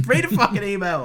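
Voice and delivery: loud, laughing, high jab; laughing mock order. Real, not acted. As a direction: bark it through a laugh.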